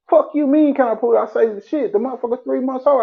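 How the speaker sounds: imitating his outraged self; incredulous retort. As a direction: fire back in disbelief.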